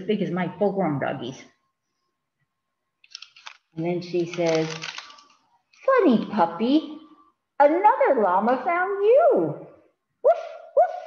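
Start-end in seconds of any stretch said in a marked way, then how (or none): 5.8-11.0 put-on 1 little girl voice. Little girl, then puppy bark.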